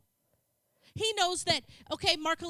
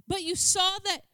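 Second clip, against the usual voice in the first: ordinary talk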